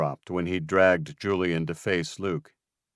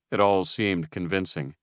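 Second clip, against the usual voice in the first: plain reading voice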